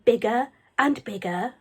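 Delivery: ordinary delivery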